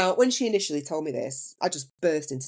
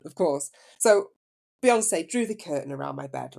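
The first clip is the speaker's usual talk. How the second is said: usual speaking voice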